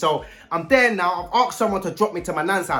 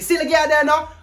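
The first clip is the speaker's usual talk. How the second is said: mock aggressive; 6 semitones higher, 3 dB louder than usual. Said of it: "A man saying it very aggressive".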